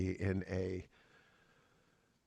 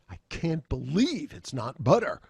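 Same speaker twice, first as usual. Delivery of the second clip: higher, incredulous emphasis on believe; appalled disbelief; 5 semitones higher than usual. Shown as a demonstration. Lecturer flips the slogan's meaning by inflection, appalled disbelief. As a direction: say it as if disgusted anyone would buy it. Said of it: "use a little different emotive, uh, inflection in your voice".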